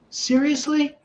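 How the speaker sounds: high-pitched disbelieving question; mildly acted "are you serious?"; incredulous. Actor reacting to boys catching his daughter's eye, incredulous. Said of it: "he's taking a breath in, like".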